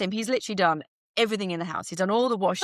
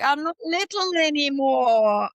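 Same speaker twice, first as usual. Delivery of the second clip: higher, louder, slower whiny protest; mock protest; 7 semitones higher, 5 dB louder, slower than usual. Protesting her husband's supermodel compliment in a high, whiny voice. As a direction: whine it back at him, hammed up.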